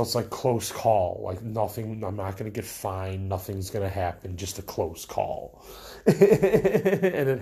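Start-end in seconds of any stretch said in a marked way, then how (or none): 5.9-7.4 slow words through a real laugh. Real, not acted. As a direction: laugh it out, slowly. Laughs at own story.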